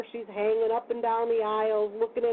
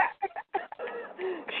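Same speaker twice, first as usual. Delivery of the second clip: laughing; 10 semitones higher, 8 dB quieter than usual. Real, not acted. Laughing while retelling crash.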